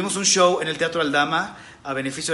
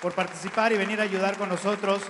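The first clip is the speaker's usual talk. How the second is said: stage announcer; 9 semitones higher than usual. Acted. Host presenting comedians on stage.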